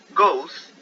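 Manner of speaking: Goes said high and loud as one vowel glide; saying goes. Shown as a demonstration. Teacher shows students the third-person form, high and loud. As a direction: model the English Goes clearly. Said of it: "porque go pasó a goes".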